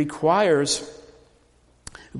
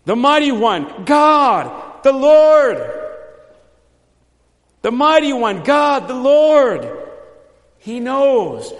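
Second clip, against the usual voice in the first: much higher, loud ringing proclamation; passionate declaration; 7 semitones higher, 8 dB louder, slower than usual. Shown as a demonstration. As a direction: cry it out in full preaching fervour. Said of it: "very emphatic and very passionate".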